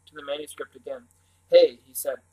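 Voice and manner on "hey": a sudden loud hey; calling out. A narrator's reading. Boy calls to his siblings at the manuscript, loudly. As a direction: grab their attention.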